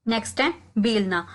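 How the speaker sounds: normal talking voice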